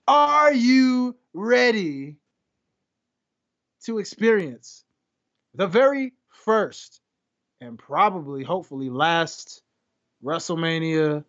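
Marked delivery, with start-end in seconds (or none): 0.1-7.0 slow, stretched, higher ring announcer call; drawn-out announcer call. Acted. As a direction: hold every word like a ring announcer.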